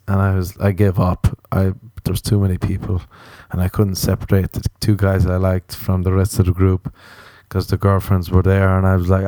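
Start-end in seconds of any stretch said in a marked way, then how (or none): none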